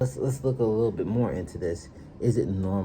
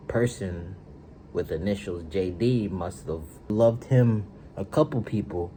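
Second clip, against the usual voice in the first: ordinary delivery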